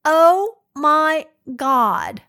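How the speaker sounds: drawn-out appalled oh my God; shocked gasp. Retold or imitated. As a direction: play a stranger stunned at the sight. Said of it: "And she goes".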